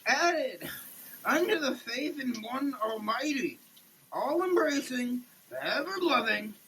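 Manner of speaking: normal talking voice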